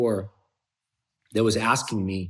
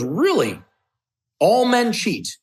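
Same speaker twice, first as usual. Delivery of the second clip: louder, high-pitched disbelieving question; incredulous challenge; 12 semitones higher, 5 dB louder than usual. Retold or imitated. Friend challenging his excuse for cheating, incredulous. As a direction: push back hard, rising high on it.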